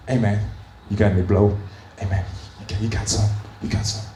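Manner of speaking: low hushed asking; low murmur; hushed dealer ask. Retold or imitated. Tourists asking for drugs.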